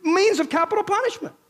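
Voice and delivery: loud, high, driving sermon voice; preaching at full force. Acted. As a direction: preach it out to the whole hall. Preacher building his case.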